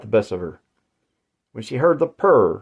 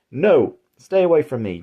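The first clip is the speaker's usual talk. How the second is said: high, louder plea to stay away; screamed; 6 semitones higher, 4 dB louder than usual. A narrator's reading. Jane fleeing up the stairs, a high raised scream. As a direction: push him off with a raised cry. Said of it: "Jane screamed".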